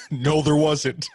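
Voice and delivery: high, loud mocking echo; imitating Frank. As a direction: parrot his denial loudly.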